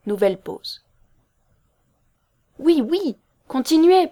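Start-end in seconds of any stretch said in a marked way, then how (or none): 2.6-4.1 high, loud, urgent prodding; pressing. A narrator's reading. Impatient man urging Loretta to continue, pressing. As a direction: push her to go on, impatiently. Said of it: "pressa-t-il".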